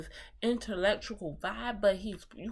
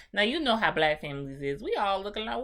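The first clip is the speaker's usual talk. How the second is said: normal talking voice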